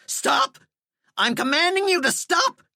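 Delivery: high frantic shouting; panicked shout. Acted. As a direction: order him off in panic. Terrified man commands skeleton.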